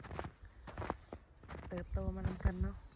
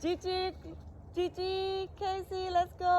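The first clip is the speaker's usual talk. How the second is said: high calling voice; 9 semitones higher, slower than usual. Real, not acted. Calling the dogs outside.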